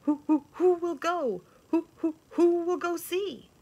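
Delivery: high, hooting who who who; hooting owl. A narrator's reading. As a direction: ask like an owl mid-hoot. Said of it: "asked the owl of many questions".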